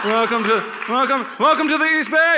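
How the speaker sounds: high, loud hammed-up welcoming local; mock the eager Danville greeting; mock welcome.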